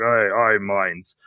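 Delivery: hint at a dark, imposing voice; Ainz. Retold or imitated. Host contrasting the dub's two voices, lightly darkened voice. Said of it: "Ainz's voice is the dark".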